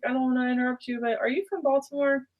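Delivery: high, eager question; hopeful question. Retold or imitated. Asking a stranger if she's from Baltimore, high and eager. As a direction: ask with hopeful excitement.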